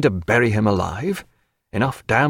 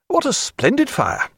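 high, delighted exclamation; gushing praise. A narrator's reading. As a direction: admire the room too eagerly. Sir Joseph admiring the room.

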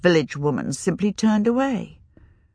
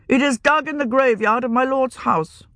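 put-on higher, proud young wizard; haughty young wizard; 7 semitones higher than usual. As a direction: speak down to the older mage.

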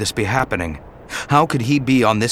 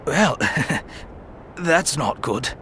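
soft, slow, wry chuckle of a hurt man; weak pained laugh; 4 semitones higher, 4 dB quieter than usual. Acted. Wounded Obi-Wan eyes his tunic, faint laugh.

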